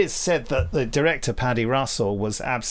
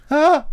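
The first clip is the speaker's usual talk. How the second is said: much higher, louder mock shriek; mock scream; 8 dB louder than usual. Host hams up a victim's scream.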